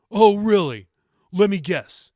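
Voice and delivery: loud, high mocking sarcasm; sarcastic teen mocking his grandmother. A narrator's reading. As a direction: sneer it out. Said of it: "Jacob inflected sarcastically".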